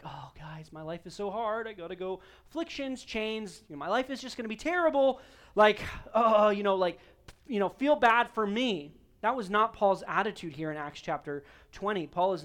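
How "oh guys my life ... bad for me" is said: mock self-pity. Preacher parodying Paul fishing for sympathy, mock self-pity. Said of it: "curry some type of pity".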